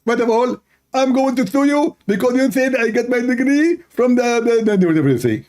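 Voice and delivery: high, strained mock preacher voice; mocking caricature of a rival debater; Zakir Naik. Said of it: "Uh, Zakir Naik".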